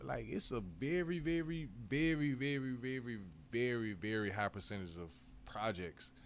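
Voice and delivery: very repeated, building emphasis; stretched very. Real, not acted. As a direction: pile up the verys for weight.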